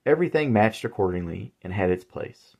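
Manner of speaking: usual speaking voice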